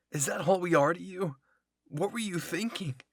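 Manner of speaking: high, loud, pained; hurt disbelief. Acted. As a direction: ask in betrayed shock. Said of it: "Connie asked".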